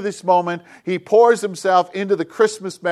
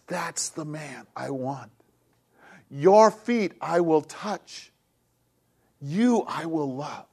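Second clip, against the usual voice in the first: slow, soft, hushed, almost a whisper; hushed voice of God; 6 dB quieter, slower than usual. Acted. Preacher voicing God to the congregation, slow and hushed. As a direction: speak God's tender promise barely above a breath.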